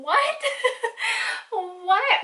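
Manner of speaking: high, loud disbelieving what with a laugh; stunned, laughing outburst at his confession; shocked laughing what. Retold or imitated.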